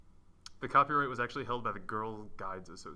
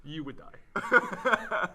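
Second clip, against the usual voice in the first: slow, laughing loud verdict; 7 semitones higher, 7 dB louder, much slower than usual. Real, not acted. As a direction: laugh it out slowly.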